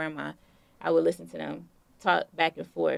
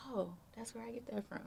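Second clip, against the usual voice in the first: quick, much softer aside to herself; hushed realization; 6 semitones higher, 15 dB quieter, faster than usual. Retold or imitated. Realizing where her cooking comes from, said quick and very soft. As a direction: murmur it fast as a private discovery.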